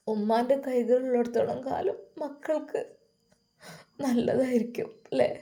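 whispered, close to tears; tearful whisper. Acted. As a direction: breathe the words out, barely voiced. Tearful daughter-in-law speaks softly. (കണ്ണീരോടെ മന്ത്രിക്കുന്ന സ്വരം)

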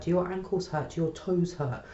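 ordinary talk, nothing marked

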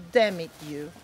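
usual speaking voice

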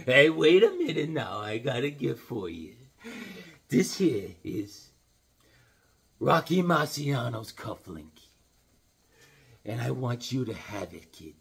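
put-on an old trainer talking gently to his fighter; lightly voiced old trainer, heartfelt; Mickey, fond talk. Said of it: "We'll do a little Mickey".

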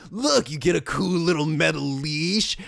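normal talking voice

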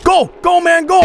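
excited high shout. Real, not acted. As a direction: cheer the character on loudly. Cheering on the game character.

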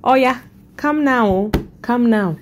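put-on high exclamation in an African accent. Shopper calling out her reaction in her head, African accent. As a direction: summon them in a strong African accent. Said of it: "in my African accent, I was like".

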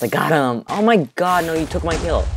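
loud, high cry of triumph turning to protest; outraged outburst. Real, not acted. Gamer protesting a stolen kill, outraged outburst. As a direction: yell it in real disbelief.

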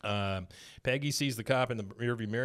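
usual speaking voice

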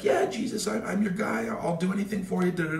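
high, eager loyal follower; a playful, high-pitched Peter pledging himself to Jesus. Retold or imitated. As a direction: pledge loyalty like a keen disciple. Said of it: "He's like".

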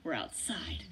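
slow, breathy whisper as a joke; whispered aside. Retold or imitated. Teacher jokes to kids about the outdoor rule, slow whisper. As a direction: whisper it to yourself, smug. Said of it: "and I'm like".

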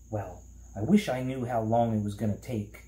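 plain reading voice

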